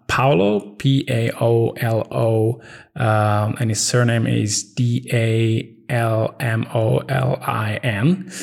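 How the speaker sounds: slow, deliberate spelling with space between letters. Real, not acted. Podcaster spelling brother's name for co-host on request, slowly. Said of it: "Say it slower".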